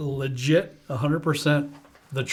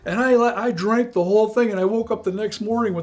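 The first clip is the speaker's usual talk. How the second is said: guy in the store; 7 semitones higher, 3 dB louder than usual. Retold or imitated. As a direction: gush like an eager stranger. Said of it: "there was a guy there ... and he goes".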